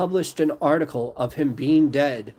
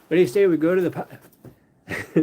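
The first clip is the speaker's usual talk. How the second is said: put-on a quick, higher stab at a Dublin accent; a tentative Irish pub-talk Dublin accent; 5 semitones higher, 4 dB louder than usual. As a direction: try on a Dublin accent, then bail out. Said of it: "how do you do the Dublin accent".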